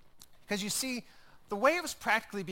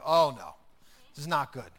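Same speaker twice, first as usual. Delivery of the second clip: dismayed email reader; 5 semitones lower, 7 dB louder than usual. Retold or imitated. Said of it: "and you're like".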